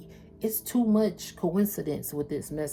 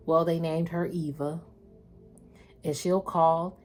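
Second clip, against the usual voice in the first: ordinary talk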